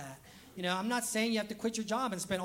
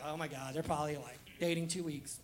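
snide onlooker; 6 semitones lower, 6 dB quieter than usual. Retold or imitated. Mocking gossiping onlookers.

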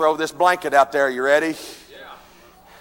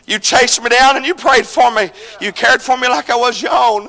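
ordinary talk

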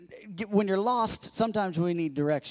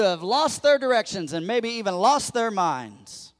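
loud, raised, drawn-out proclamation; forceful preaching; 5 semitones higher, 6 dB louder than usual. Real, not acted. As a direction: drive each promise home to the congregation.

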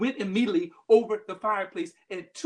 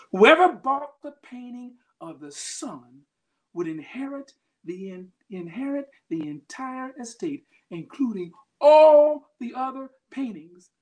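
higher, rising preacher's cadence; preaching; 8 semitones higher, 4 dB louder than usual. Acted. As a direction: drive the message home to the congregation. Preacher drives the point home.

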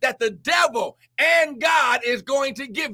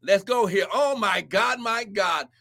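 ordinary talk, nothing marked

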